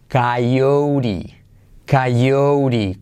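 coyote as "kai-OU-ri", falling; English pronunciation. Shown as a demonstration. Teacher revealing the English word, coyote as "kai-OU-ri". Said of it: "se dice".

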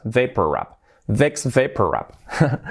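Vex Vapor Rub as "VIKS VAY-per rub"; slow English model of Vex Vapor Rub; English pronunciation. Shown as a demonstration. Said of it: "sería Vex Vapor Rub".

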